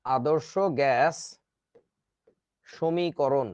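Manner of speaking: very slow, spaced out for note-taking; slow dictation. Shown as a demonstration. Teacher dictating the term to students, very slow. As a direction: drag each word so they can write. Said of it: "এইটাকেই বলে হচ্ছে".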